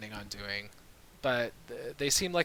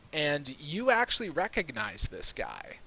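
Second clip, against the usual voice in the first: ordinary talk, nothing marked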